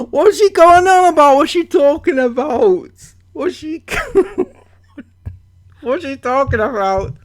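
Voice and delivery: What's she going on about, thrown out in disbelief; incredulous outburst. Real, not acted. Exasperated reaction to comment.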